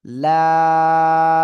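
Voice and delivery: লাম stretched over four beats, loud and level; long laam. Shown as a demonstration. Teacher showing students the four-alif stretch, long loud laam. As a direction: hold লাম long and steady, full voice. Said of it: "চার আলিফ টেনে পড়তে হবে".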